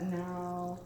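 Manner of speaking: ordinary delivery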